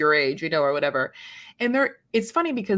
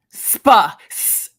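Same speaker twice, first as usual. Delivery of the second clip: angry spa; 6 dB louder, much slower than usual. Shown as a demonstration. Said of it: "Less anger".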